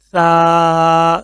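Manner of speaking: سا stretched long and soft; slow, soft سا. Shown as a demonstration. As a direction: draw out a gentle سا. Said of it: "soft کر کے".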